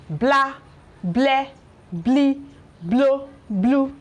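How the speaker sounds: blah bleh blee blow blue, each syllable said alone with a clear L; slow, separate syllables with a crisp L; syllable drill. Shown as a demonstration. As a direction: drill the L blends one by one. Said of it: "Watch how I pronounce this".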